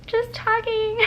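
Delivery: high-pitched innocent cover-up; mock-casual high little voice; feigned innocence. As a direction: chirp it, hiding the secret. Feigned innocence when asked again.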